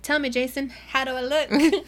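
fish for a compliment; coy flirt. Retold or imitated. Winter flirting with guard.

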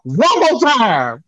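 heated, drawn-out warning; getting angry. Real, not acted. Host warns Producer Brittany, getting angry and heated. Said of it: "I'm getting angry".

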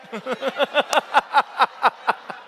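long, high run of laughs; real cracking-up laugh; helpless laughter. Real, not acted. Cracks up at the joke.

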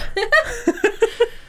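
short high laugh. Real, not acted. Laugh at Bond.

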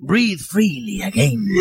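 put-on slow Spanish-accented ad tagline; Antonio Banderas impression. Nasal spray mascot in an ad, slow Spanish accent. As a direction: purr it slowly like a Spanish-accented commercial bee. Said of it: "Antonio Banderas became the Nasal Next B".

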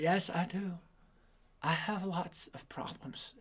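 put-on boy quietly admitting to his grandfather, sheepish; sheepish admission. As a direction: mumble the confession to grandpa.

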